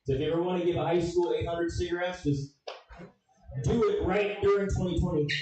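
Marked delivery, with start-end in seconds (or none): none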